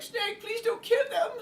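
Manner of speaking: put-on very high, slow pleading girl. Girl character in the game begging Snake, high and pleading. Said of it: "It's like".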